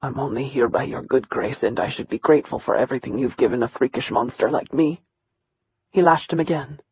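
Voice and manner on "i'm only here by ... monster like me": lower, softer, strained with pain; taut with pain. Acted. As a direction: force the words through the pain. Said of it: "Nakirian's voice was taut with pain".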